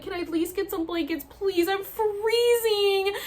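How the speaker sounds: high begging whine, rising into a drawn-out wail; desperate pleading. Retold or imitated. Freezing patient begging helpers for blankets, high acted wail. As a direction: act out begging, last word stretched and loud. Replaying her plea for blankets.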